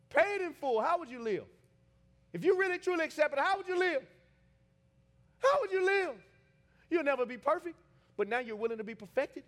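high, loud, driving sermon; full-force preaching. Real, not acted. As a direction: press the question on the congregation.

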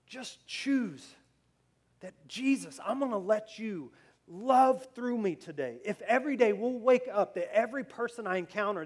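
high, loud, fervent exhortation; impassioned preaching. Real, not acted. Preacher urging congregation to love one another, impassioned. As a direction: preach it forcefully, stretching the key words.

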